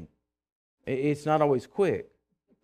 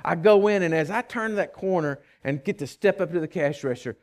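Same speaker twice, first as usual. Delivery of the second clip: normal talking voice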